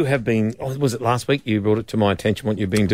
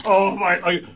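fake sick voice; 6 semitones higher than usual. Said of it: "and you do that".

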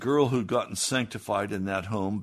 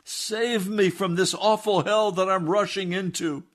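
high, loud crying out to God, slowing at the end; desperate cry; 10 semitones higher, 7 dB louder, slower than usual. Retold or imitated. Jim in the wagon crying out to God for mercy, high and loud. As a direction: wail for mercy. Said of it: "he burst out".

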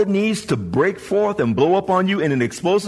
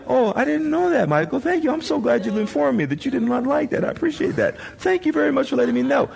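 higher, sugary overpolite reply; mock polite thanks; 7 semitones higher, faster than usual. The screamed-at person thanking Michael sweetly, mock polite. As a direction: play the gracious listener nobody really is. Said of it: "They're not like".

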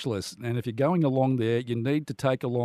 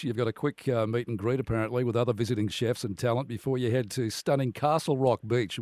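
usual speaking voice

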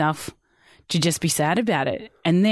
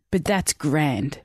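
usual speaking voice

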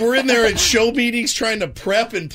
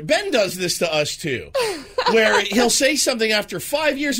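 ordinary delivery

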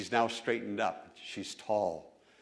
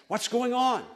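a blind man asking, pitched up a little; curious call from the roadside; lightly voiced blind man; 11 semitones higher, 3 dB louder than usual. Blind Bartimaeus asking the crowd, lightly voiced. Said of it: "Bartimaeus apparently asked".

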